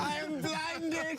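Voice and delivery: high, very slow hammed-up wail of being blinded; mock blinded. Interviewer mocking the actor's blinding, high slow wail. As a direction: overact a man dazzled by light.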